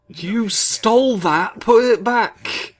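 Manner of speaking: loud, high, drawn-out scolding; mock outrage. Commentator scolding a player who stole the flowers, mock-outraged shout. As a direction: call out the thief, stretching each word. Calling out a theft in game.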